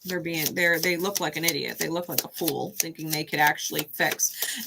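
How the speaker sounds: usual speaking voice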